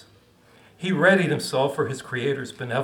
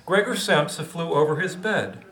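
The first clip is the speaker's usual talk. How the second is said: ordinary delivery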